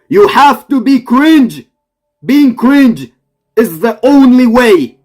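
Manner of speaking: high, loud proclamation; mock-grand proclamation. Announcing the only way to love, high loud proclamation. Climactic mock declaration of his realization.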